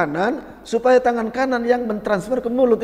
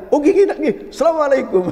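cheerful woman's farewell; 7 semitones higher than usual. Retold or imitated. Woman thanks and leaves.

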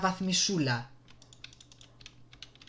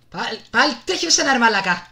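loud, higher exasperated shout; angry yell; 8 semitones higher, 13 dB louder than usual. Real, not acted. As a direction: yell in frustration at him. (θυμωμένο δυνατό ξέσπασμα)

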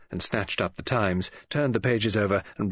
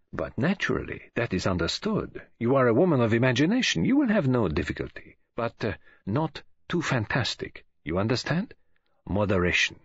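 Poirot, cautioning; 2 semitones higher than usual. Acted. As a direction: flatter her, then caution with careful pauses.

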